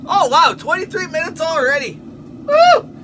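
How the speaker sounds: high, loud, thrilled cheer; real excited hollering; excited whoop. Real, not acted. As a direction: whoop it up, pitch shooting way up. Cheering at time already passed.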